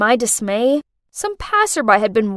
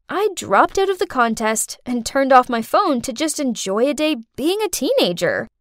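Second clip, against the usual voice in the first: plain reading voice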